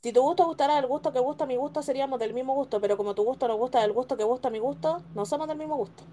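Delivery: fast, rattled-off tongue twister; rapid recital. Shown as a demonstration. Player recites a memorized tongue twister to friends, fast. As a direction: race through it from memory.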